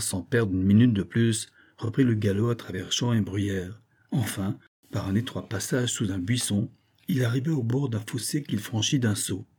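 ordinary delivery, nothing marked

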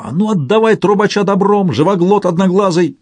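put-on high, piercing girlish threat; Tarakan's piercing girlish voice. A narrator's reading. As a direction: demand it in a shrill, girlish pitch. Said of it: "раздался пронзительный девчачий голос таракана".

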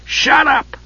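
high-pitched groan of exasperation; Flint groaning. A narrator's reading. Dwarf silences the prattling Kender, groaning in exasperation. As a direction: cut him off with a pained groan. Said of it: "Flint groaned".

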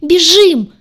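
very loud, high scream; frantic yell. Acted. Heroine screams at everyone to run, frantic. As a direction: scream it at full force. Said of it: "истошно завопила я".

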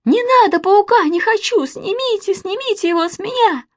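very high, frantic squeal; wailing like a terrified small child; panicked screaming. A narrator's reading. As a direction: beg hysterically to be rescued. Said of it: "вдруг закричал Костя".

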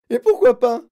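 high, very loud challenge; howling. A narrator's reading. Mother challenges Wonka's refusal, howling. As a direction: scream the question back. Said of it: "hurla Madame Gloop".

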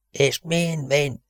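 high, soft breathless rasp; panting, hoarse bark. A narrator's reading. The Tiger vows all will follow, panting. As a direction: pant the words out hoarsely. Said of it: "Lihegte reket, ugató hangon".

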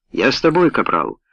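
ordinary delivery

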